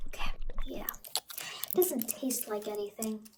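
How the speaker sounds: soft unvoiced whisper; quietly approving whisper. After applying to tongue.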